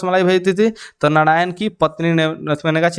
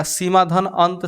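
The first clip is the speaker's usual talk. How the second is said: slow dictation; slower than usual. Shown as a demonstration. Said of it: "plus मत पढ़िएगा, इसको धन पढ़िएगा".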